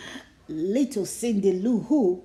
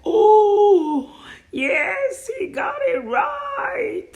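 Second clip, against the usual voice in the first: much higher, loud, slow cry of delight; joyful cheer; 11 semitones higher, 7 dB louder, much slower than usual. A narrator's reading. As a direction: cheer him on. Narrator cheers the Grinch.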